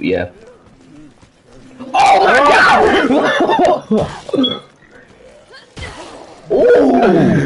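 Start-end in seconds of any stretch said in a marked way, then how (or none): 1.8-7.5 shrieking laugh, high and slow; laughing scream. Real, not acted. Jump scare laugh.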